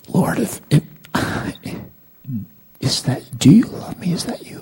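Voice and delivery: high, halting, faltering prayer; timid questioning. Retold or imitated. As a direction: stammer it upward, unsure God is there. Timid retold question to God.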